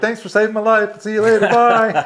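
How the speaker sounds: high, loud, sarcastic goodbye breaking into a laugh; laughing sarcastic thanks; mock breezy farewell. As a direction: toss it off like a cheeky joke. Mock farewell after near miss.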